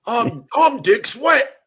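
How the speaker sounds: high, loud, cartoonish British "mate" voice; mock British accent. The British actor mocked, puzzled by the Green Arrow offer, in a hammed-up British accent. Inventing a silly actor name.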